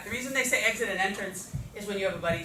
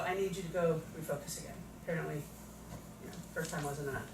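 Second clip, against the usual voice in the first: quiet, lower, matter-of-fact teacher; calm redirection; 5 semitones lower, 11 dB quieter, faster than usual. Shown as a demonstration. Teacher re-sending a repeat offender to refocus, quiet and unbothered. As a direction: send them back without any fuss.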